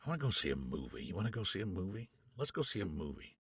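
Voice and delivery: low and soft, eager invitation; lightly voiced Jonathan. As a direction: quietly coax a friend to the movies. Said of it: "he's like".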